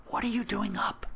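high-pitched, hushed scolding; angry whisper. A narrator's reading. Mother finds her son out of bed, cross high whisper. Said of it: "She sounded angry".

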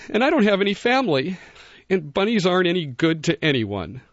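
high, sad little bunny voice. A narrator's reading. Bunny answers the wolf.

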